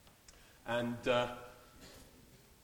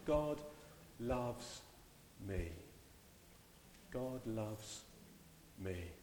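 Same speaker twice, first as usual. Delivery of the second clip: soft and hushed, turned inward; said quietly to yourself; 2 semitones lower, 9 dB quieter, much slower than usual. Shown as a demonstration. Pastor has congregation say it quietly to themselves. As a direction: murmur it to yourself. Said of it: "say it just quietly to yourself".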